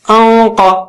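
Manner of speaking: انقا with a thick nasal hum before ق; انقا drawn out, heavy and nasal. Shown as a demonstration. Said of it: "نون ساکنہ بھی اونچا ہو جائے گا".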